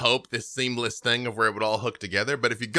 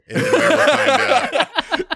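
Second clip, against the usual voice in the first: laughing; 9 dB louder than usual. Real, not acted. Laughing high joke.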